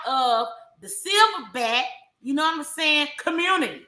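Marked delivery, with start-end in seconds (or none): none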